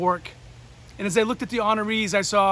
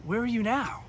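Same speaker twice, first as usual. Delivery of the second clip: high-voiced, softer question; Walter Payton; 3 semitones higher, 4 dB quieter than usual. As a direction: ask in a gentle put-on high voice. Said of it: "Walter had a high voice".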